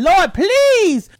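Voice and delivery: loud, high hammed-up plea to God; desperate praying. Host acting out praying on her knees, desperate plea. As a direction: pray it loud, dragging out the please. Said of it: "all on my knees praying".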